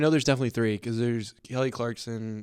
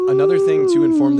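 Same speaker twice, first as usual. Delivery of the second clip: voice crack; 14 dB louder than usual. Real, not acted. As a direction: voice cracks high and loud mid-sentence. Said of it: "the worst voice crack of all time".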